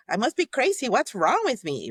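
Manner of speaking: high-pitched self-reproach; acted self-judgment. Judging ourselves for our feelings, mock exasperated. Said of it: "we judge ourselves".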